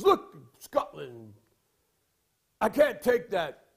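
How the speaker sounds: slow, loud mock British accent; put-on British accent, slow and forceful; thick British accent. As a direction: imitate an outraged Brit, drawn out and loud. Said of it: "with his British accent".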